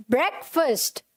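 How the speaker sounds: ब्रेकफास्ट as "BREK-fust"; short e and reduced second vowel in ब्रेकफास्ट; correct pronunciation. Shown as a demonstration. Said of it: "तो कैसे बोलेंगे इसे?".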